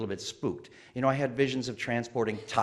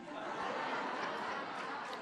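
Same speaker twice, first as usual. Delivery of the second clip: soft airy laugh; breathless laugh; 14 dB quieter than usual. Real, not acted. Storyteller laughing at his own punchline, soft airy laugh.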